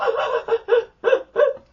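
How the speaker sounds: high, loud staccato mock shriek; rapid high-pitched panicked noises; startled stammering yelp. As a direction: imitate being scared awake. Imitates own startled noise on waking.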